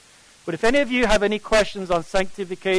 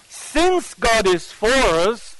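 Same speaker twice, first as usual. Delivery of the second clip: "Since" slow and lifted at the start of the phrase; stress on since; 4 semitones higher, much slower than usual. Shown as a demonstration. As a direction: read it slowly, leaning on "Since". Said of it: "write in your Bibles, the word since".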